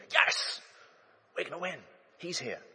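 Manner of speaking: a high, breathless cheer that drops away; a schoolboy thrilled that the star joins his side; excited kid. Retold or imitated. A kid at lunchtime cheers his new teammate, high and excited.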